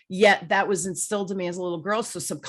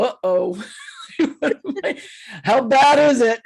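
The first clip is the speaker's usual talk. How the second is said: laughing nervously, then louder at the question; 5 semitones higher, 7 dB louder than usual. Real, not acted. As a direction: giggle through it, bracing for bad news. Nervous joke at the reading.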